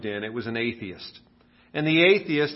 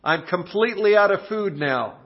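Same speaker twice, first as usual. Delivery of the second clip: higher, louder, hopeful prayer; widow praying; 6 semitones higher, 4 dB louder than usual. Acted. Widow praying to God with no food left, hopeful. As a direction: pray up, worried yet trusting. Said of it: "lifts her arms and says".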